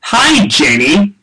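slow, loud, deadpan word by word; reading a typo-filled tweet. Pretend. Host reading a fan's garbled tweet aloud, slow and loud. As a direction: read each word stiffly as misspelled. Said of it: "I'm gonna read it as he has written it".

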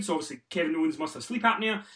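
ordinary talk

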